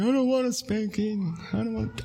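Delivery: retelling his daughter's tearful plea to avoid a spanking; imitating crying child. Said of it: "And she's crying".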